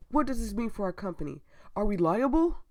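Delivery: loud, high, agitated questioning; alarmed board member. Acted. Board member fearing what investors will hear, loud acted outrage. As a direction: fire off outraged questions at the doctor.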